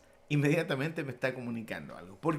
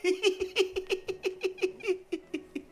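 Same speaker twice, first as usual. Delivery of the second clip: high, soft "ji ji ji" giggle; laughing on I; 14 semitones higher, 4 dB quieter than usual. Shown as a demonstration. Preacher showing a laugh on the letter I, high giggle. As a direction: squeak out a laugh on I. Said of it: "O la I".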